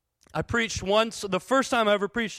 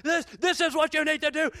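mock yell; 9 semitones higher than usual. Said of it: "I just start yelling at people".

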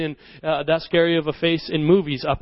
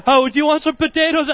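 higher, laughing-edged nervous retake; nervous offer; 9 semitones higher, 4 dB louder than usual. Retold or imitated. Kid repeating the offer through laughter, nervous and higher. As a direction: blurt it again, embarrassed. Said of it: "I'm like".

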